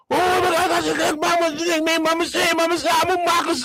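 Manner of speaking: high-pitched imitation African-language gibberish; mock African talk. Driver on phone with lady, high mock African chatter. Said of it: "that African stuff".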